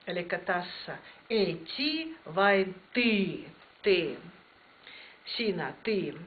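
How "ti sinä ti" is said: usual speaking voice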